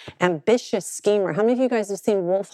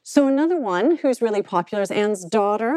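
normal talking voice

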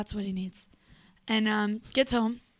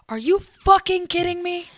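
furious high scream; full-volume shout at her drunk brother; screaming at the top of her lungs; 8 semitones higher, 9 dB louder than usual. Retold or imitated. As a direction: yell it as loud as you can, outraged. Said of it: "Top of my lungs".

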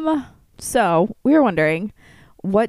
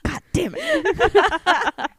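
drawn-out, high mock curse; comic retold frustration; mock exasperation; 9 semitones higher, much slower than usual. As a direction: stretch out the exasperation for laughs. Retold curse amid laughter.

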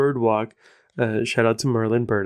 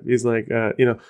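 usual speaking voice

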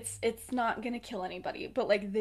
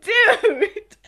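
high, loud, laughing exclamation; mock exasperated cry; 11 semitones higher, 14 dB louder than usual. Sirius protesting to Regulus, mock exasperated cry. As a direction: belt it out in playful exasperation.

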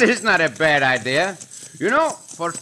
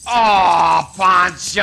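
loud mock scolding; exasperated shout; 4 dB louder, much slower than usual. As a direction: call him out, laughing.

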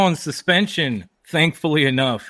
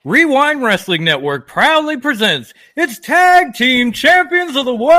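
booming ring announcer, high and loud; ringmaster; 10 semitones higher, 7 dB louder than usual. Pretend. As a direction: bellow it like a circus ringmaster. Podcast intro as ring announcer.

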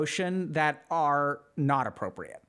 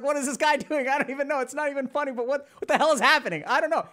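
high, bright baffled chuckling; pitched up, giggly bewilderment; amused confused viewer; 8 semitones higher, 4 dB louder, faster than usual. Retold or imitated. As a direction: laugh along, puzzled. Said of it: "you're like".

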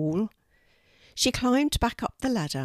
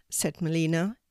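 plain reading voice

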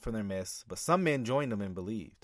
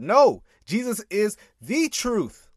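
"the" said as a stressed "thee", slow and high; loud, slow rebuttal leaning hard on "the truth"; emphatic stress on "the"; 7 semitones higher, 6 dB louder, slower than usual. Real, not acted. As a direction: punch "No", then stretch "the" into "THEE" before "truth". Corrects "our truth" with "the truth.

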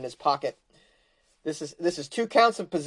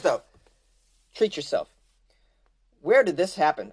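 normal talking voice

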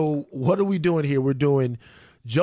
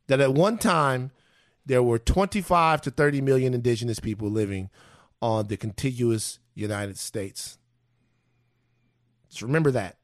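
solemn sincere; 6 semitones lower than usual. Real, not acted. Solemn Thanksgiving remembrance message.

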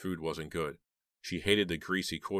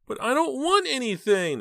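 very high, slow, loud whine; whining little boy; 7 dB louder than usual. A narrator's reading. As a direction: call out like a protesting small child. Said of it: "the little boy called after her".

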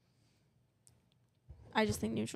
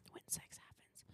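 breathy whispered name; 23 dB quieter than usual. Pretend. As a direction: hush it like a secret. Whispered podcast name reveal.

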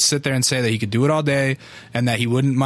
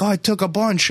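helpful stranger; 7 semitones higher than usual. Retold or imitated.

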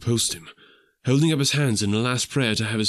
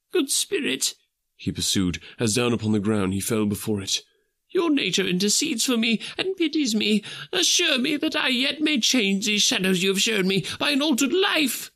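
much higher humble pleading; Scrooge, imploring; 13 semitones higher than usual. Acted. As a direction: beg the kind spirit for a second chance. Scrooge begs on the ground.

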